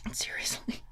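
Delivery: soft breathy whisper; whispered aside. Real, not acted. Aside to viewers, whispered. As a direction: drop to a secret whisper.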